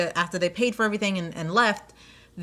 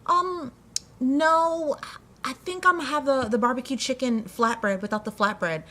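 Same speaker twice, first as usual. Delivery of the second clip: a much higher, prim mock-customer voice; imitating the stubborn customer; 5 semitones higher than usual. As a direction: play the customer sweetly insisting on her odd order. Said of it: "She was like".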